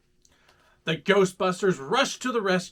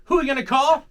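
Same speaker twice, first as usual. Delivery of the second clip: shouted; 8 semitones higher, 8 dB louder, much faster than usual. Acted. Theme song call-and-response.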